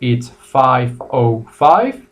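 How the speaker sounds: slow, careful five oh five; American time, slow. Shown as a demonstration. Teacher modelling American time for students, slow and clear. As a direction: spell out five oh five slowly. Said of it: "two ways to say it".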